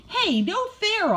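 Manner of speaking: high-pitched puppy complaint; barked protest. A narrator's reading. Puppy to Charlie who grabbed the treat, barking. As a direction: yelp it indignantly. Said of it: "I bark".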